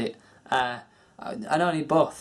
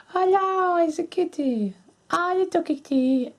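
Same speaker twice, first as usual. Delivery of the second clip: much higher sing-song cooing at the kitty; slower than usual. Real, not acted. Greeting his cat on camera, in high cooing baby talk.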